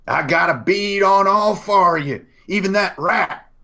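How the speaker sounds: high hollered threat; yelling hostile stranger. Acted. Unseen stranger threatens the four travelers, yelled warning. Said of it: "as he yells".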